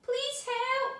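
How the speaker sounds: lost princess calling again for help, high and loud; cry for help. A narrator's reading. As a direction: call out again, pleading. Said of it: "she cried".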